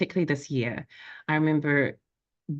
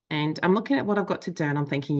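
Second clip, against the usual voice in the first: ordinary talk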